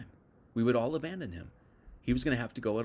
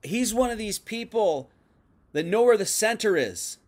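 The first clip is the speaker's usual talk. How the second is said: raised, higher voice, pointing out a follower; loud accusation; 6 semitones higher, 6 dB louder than usual. Retold or imitated. Accusers in the crowd denounce Peter with a loud cry. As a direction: call him out loud, like an angry accuser. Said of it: "So they're saying".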